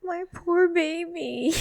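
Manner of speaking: high, slow, pitying coo; sympathetic. Real, not acted. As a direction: coo it with pity. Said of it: "sympathy for the friend".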